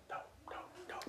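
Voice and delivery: whispered. Retold or imitated. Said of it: "and I said".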